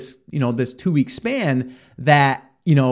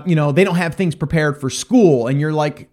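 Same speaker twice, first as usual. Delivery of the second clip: normal talking voice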